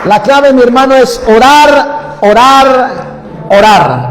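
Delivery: high, loud fervent declaration; rousing prophetic shout; full-force preaching. Real, not acted.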